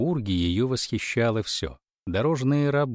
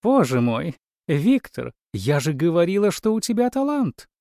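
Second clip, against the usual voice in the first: loud, higher gushing delight; ringing crystal chime voice; 7 semitones higher, 4 dB louder than usual. A narrator's reading. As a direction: gush with ringing rapture.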